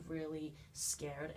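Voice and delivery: ordinary talk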